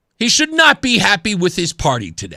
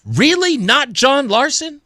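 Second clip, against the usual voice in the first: incredulous question, stretched on the name; stunned disbelief; 3 semitones higher, slower than usual. Acted. Stunned listeners reacting to the news, mock incredulous. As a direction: question it as if you can't believe it. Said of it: "I'm stunned. I'm sure that many of you are".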